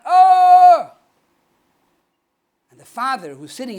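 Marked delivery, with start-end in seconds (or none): 0.0-0.9 long, loud, high wordless call; frustrated cry. Acted. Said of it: "out of frustration, he calls out".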